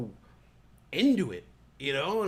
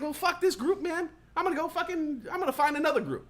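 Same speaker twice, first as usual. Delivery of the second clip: higher, dismissive give-up; fed up; 9 semitones higher than usual. Retold or imitated. Leaving the complainers, higher dismissive shrug. Giving up on group.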